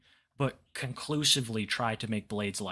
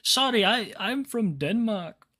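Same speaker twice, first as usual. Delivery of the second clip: mock innocent excuse; 9 semitones higher, 4 dB louder than usual. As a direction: plead innocence like a foreigner. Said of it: "I'm gonna start trying that when I get in trouble".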